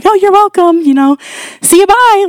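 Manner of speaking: high-pitched, flustered sign-off; panicked goodbye. Retold or imitated. Driver fleeing after praying for cashier, panicked. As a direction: act out an awkward panicked exit. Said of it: "Like, I panicked, seriously".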